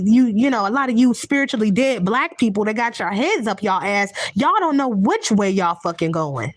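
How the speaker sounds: normal talking voice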